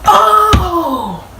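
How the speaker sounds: high, long-held startled cry; swerving driver's drawn-out yelp. Shown as a demonstration. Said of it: "like that".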